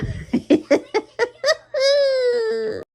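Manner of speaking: laughing till near tears, high; tearful wheezing laugh; long high helpless laugh. Real, not acted. Vlogger dying laughing at her craft fail, high laugh. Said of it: "I'm still dying laughing".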